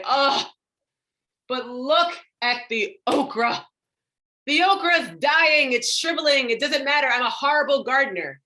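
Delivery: high, loud dramatic dismay; exaggerated self-criticism. Perfectionist gardener fretting over dying okra, dramatic dismay. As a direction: wail over it in mock despair.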